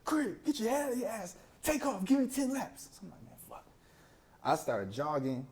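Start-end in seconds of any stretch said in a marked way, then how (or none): 0.1-2.9 much higher coach voice, mock snapping. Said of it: "He just snaps, how you should as a coach". 2.9-3.7 much softer, under the breath; muttered curse. Retold or imitated. Muttering after being punished.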